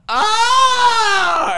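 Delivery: high, loud, strained wail of frustration; frustrated wail. Retold or imitated. Teenage daughter venting in the car, high frustrated wail. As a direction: let out a shrill fed-up wail.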